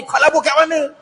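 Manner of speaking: high suspicious demand; Umar questioning. Acted. Umar interrogates Abu Darda about his investment, suspicious. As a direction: voice Umar pressing for answers. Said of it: "Umar pantang dengan bab labur ni".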